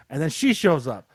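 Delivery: normal talking voice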